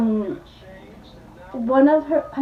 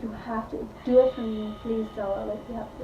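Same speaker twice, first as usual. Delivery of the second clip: hushed, low-energy retold plea; soft pleading; 3 semitones lower, 9 dB quieter than usual. Guy begging Stella to take the lungs, soft plea. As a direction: beg softly, fading away at the end.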